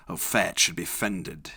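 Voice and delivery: ordinary delivery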